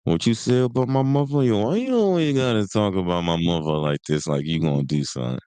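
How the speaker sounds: singsong mimicry of a New York tough; Kanan. Imitating a TV character's talk about his mother, singsong street voice. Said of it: "That's how Kanan".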